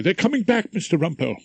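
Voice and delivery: anxious court usher calling out; urgent usher. Acted. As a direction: hurry the barrister back to court. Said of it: "he said urgently".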